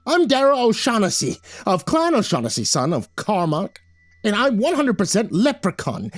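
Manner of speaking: high, fiery, proud tirade; furious little leprechaun voice; growling leprechaun indignation. A narrator's reading. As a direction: declare it hotly, pitch climbing with outrage. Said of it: "and then he growled".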